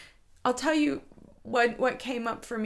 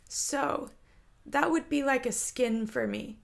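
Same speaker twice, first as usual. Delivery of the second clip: ordinary talk, nothing marked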